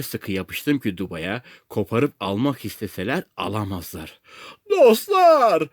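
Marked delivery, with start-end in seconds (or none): 4.7-5.7 high, loud, drawn call; shouted appeal. A narrator's reading. Castaway calls out to his rescuers, shouted appeal. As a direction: holler out to attract the crew. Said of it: "diye bağırdım".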